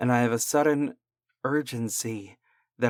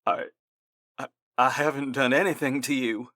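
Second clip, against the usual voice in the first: fast, panicked stammer; terrified stammering plea; squeaking in fear; 2 semitones higher, faster than usual. Acted. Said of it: "the man squeaked".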